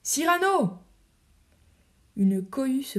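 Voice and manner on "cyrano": high call of the name from outside; crowd calling. Acted. The crowd outside calling Cyrano's name. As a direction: hail him like a cheering crowd. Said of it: "La foule criant dehors".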